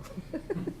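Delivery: a quiet airy laugh to close out; little exhale of a laugh; soft breathy chuckle. Real, not acted. Presenter chuckling softly after finishing. End of explanation, light chuckle.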